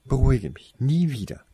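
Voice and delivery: high-pitched exasperation; irritated rebuke. A narrator's reading. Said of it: "fuhr sie ihn an".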